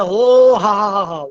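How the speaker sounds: usual speaking voice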